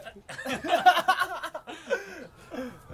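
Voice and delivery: high open laugh; real amused laugh. Real, not acted. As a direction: laugh freely at the joke. Laughing together.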